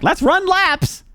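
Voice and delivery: high, slow mock coach call; gym teacher. Gym teacher orders the fat kid to run, high and drawn out. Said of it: "the gym teacher was like".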